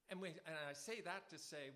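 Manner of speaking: ordinary talk, nothing marked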